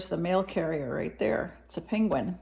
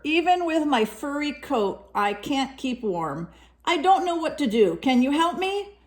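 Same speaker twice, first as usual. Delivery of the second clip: a hint of a higher, pleading polar bear voice; lightly voiced polar bear; 6 semitones higher than usual. Reading the bear's letter to a child, lightly voiced polar bear. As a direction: read the letter as a gentle bear asking for help.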